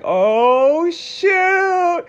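jumps up high on shoot, caught out; a startled little oh shoot; mock caught-out surprise; caught off guard. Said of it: "that whole look".

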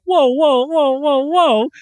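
high-pitched run of halting whoas; alarmed objection. Retold or imitated. Viewer objecting to the show's twist, alarmed objection. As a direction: cut in to stop them, high and insistent.

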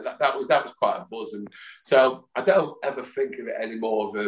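ordinary talk

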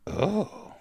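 put-on high, soft old-lady "oh"; a small jealous coo in an old lady's voice. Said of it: "Uh, jealousy".

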